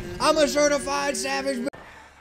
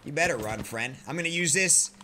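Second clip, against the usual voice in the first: ordinary talk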